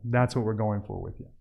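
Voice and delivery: normal talking voice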